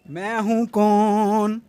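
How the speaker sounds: slow, drawn-out filmi dialogue; dramatic punchy hero lines. Acted. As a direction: deliver it like a Bollywood hero. Filmi dialogue intro repeated.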